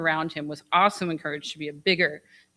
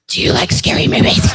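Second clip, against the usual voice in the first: Scream killer voice; 11 dB louder than usual. Retold or imitated. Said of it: "called me for months. I mean, months, going".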